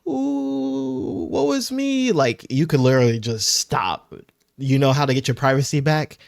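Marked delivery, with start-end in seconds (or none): 0.1-2.1 high-pitched, drawn-out fake crying; hammed-up crybaby whimper, pitched way up; mock boohoo whining. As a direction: wail it in a slow, high mock sob. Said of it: "They're, like, boohoo whining and gr-, crying".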